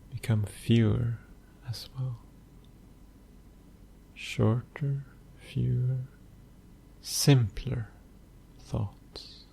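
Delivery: soft, unhurried meditation voice with long pauses; hushed, even delivery, letting phrase ends fade; guided meditation. Acted. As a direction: guide the listener gently into stillness, phrase by phrase.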